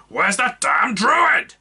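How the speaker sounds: bellow. Acted. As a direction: bellow across the crowd. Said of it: "she bellowed".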